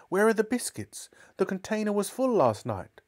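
very high, urgent questioning; high-pitched indignation; alarmed, accusing mother. Acted. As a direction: confront him about what is missing. Mother confronts the cook.

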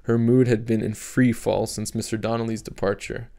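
ordinary delivery

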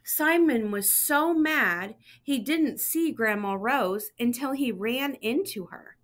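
ordinary delivery, nothing marked

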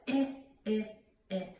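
"Eh" as the short i of inchworm; letter sound demo. Shown as a demonstration. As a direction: sound out short "ih" three times, spaced apart. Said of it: "Any Inchworm says".

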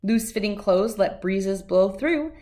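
usual speaking voice

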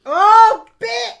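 high, loud excited yelp; excited yell. Real, not acted. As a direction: shout it out with glee. Reacting to death nut reveal.

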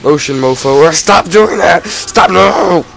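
loud, high angry shouting. Real, not acted. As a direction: shout angrily at the enemy. Being airblasted by enemy.

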